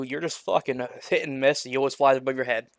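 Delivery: usual speaking voice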